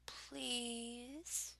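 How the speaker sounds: hushed, rising begging please; mock plea. Reading a dirty word list, she begs softly in pretend.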